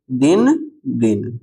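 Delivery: दिन दिन spaced out slowly; slow word-by-word दिन दिन for learners; slow dictation. Shown as a demonstration. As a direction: say each दिन separately, drawn out. Repeats answer slowly for learners.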